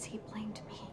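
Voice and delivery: broken hushed whisper. Acted. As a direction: whisper it, haunted by guilt. Doctor haunted by father's blame.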